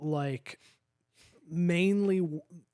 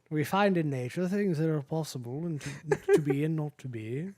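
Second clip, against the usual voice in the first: British accent. Shown as a demonstration. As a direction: hold the British accent through it. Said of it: "with a British accent".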